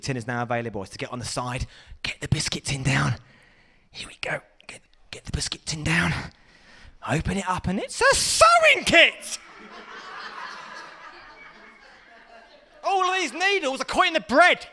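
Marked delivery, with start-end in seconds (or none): 2.0-4.4 sneaky stage whisper; stealthy whisper. Acted. Young lad sneaking the biscuit tin, stealthy whisper. 4.7-6.1 sneaky stage whisper; stealthy whisper. Acted. Young lad sneaking the biscuit tin, stealthy whisper. 7.1-14.7 outraged shout. Acted. Lad finding a sewing kit in the tin, outraged shout.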